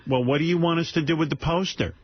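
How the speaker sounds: high, hesitant mom voice; imitating his mother. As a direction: ask it like his fussing mother. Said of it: "my mother goes".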